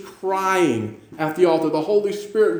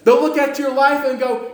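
ordinary talk, nothing marked

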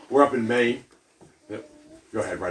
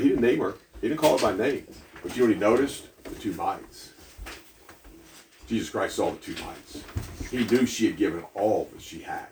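ordinary delivery

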